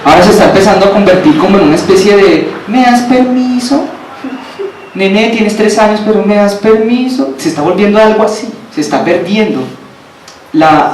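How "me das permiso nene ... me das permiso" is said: put-on high, slow, begging parent asking a toddler; mock a parent pleading with their small child. Preacher mocks a parent begging a three-year-old, high and slow.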